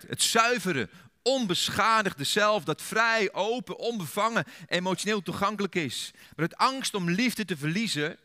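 high, loud, impassioned preaching; fervent sermon quote; preaching at full force. Acted. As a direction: lift it up and proclaim it. Quoting Alice Miller passionately.

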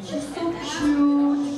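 ordinary delivery

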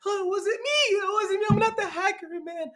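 high, whiny mock sob of denial; mock crying Kareem. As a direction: pitch it way up like a fake crybaby denying everything. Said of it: "He cried in the Twitch, like".

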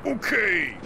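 drawn-out game-character voice; Donkey Kong impression. Streamer showing off an impression, drawn-out character call. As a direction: call it out like a cartoon ape. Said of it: "Donkey Kong sixty-four impression".

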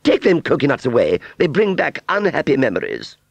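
high, loud, haughty dismissal; impatient order. Acted. Aunt Sally sending Wurzel's coconuts away, impatient. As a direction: order him away testily. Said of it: "she demanded impatiently".

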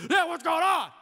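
loud, high mock teen yelling; mock teenager. Pastor voicing a teenager losing Wi-Fi, loud and high. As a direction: ham up a panicked teen with no Wi-Fi.

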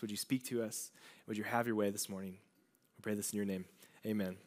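soft, low prayer voice; hushed, humble congregational prayer. Real, not acted. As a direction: pray quietly and sincerely to God. Leads a prayer.

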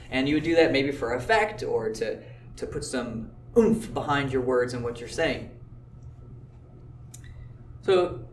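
ordinary talk, nothing marked

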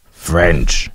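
low, flat French; lightly voiced dismissive Parisian; mocking the French. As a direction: drop the voice and deadpan the word like a curt clerk. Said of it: "and they go like".

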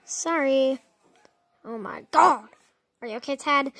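ordinary delivery

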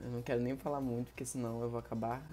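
acted sadness. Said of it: "vamos aqui incorporar no teatro".

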